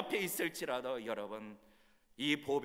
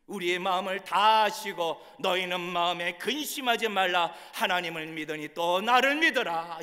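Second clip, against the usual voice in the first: high, loud, impassioned sermon climax; full-force preaching; 6 semitones higher, 13 dB louder than usual. Acted. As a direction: drive it out to the whole congregation at full voice. (높고 우렁차게 외치는 열정적인 설교)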